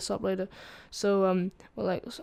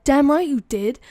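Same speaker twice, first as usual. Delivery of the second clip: loud, raised retort; confronting principal; 5 semitones higher, 8 dB louder than usual. Retold or imitated. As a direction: imitate an angry principal. Retells principal confronting them.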